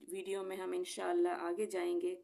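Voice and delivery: ordinary delivery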